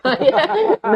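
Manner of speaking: short amused laugh at himself; real burst of laughter. Real, not acted. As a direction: laugh out at how it turned out. Laughs at his own story.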